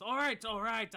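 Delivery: high, loud frantic plea to stop; panicked yielding. Acted. Guard begs the half-orc to stop, frantic and loud. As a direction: beg for mercy with sword overhead.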